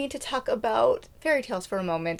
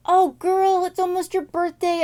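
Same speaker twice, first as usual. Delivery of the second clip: put-on high, chummy "oh girl" voice; sassy fairy; 8 semitones higher than usual. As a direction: coo it like a sassy friend. Fairy consoling princess.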